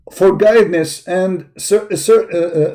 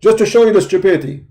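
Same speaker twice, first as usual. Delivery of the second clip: ordinary talk, nothing marked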